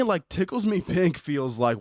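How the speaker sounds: usual speaking voice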